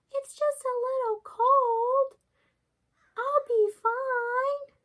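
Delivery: high, slow put-on granny voice; a wolf squeaking like a sweet old granny; wolf faking grandmother. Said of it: "disguise himself as the grandmother".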